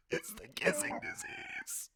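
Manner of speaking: high breathy whisper; creepy whisper. Acted. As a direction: breathe it out eerily. Squiddy whispers.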